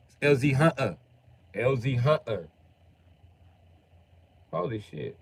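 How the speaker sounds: usual speaking voice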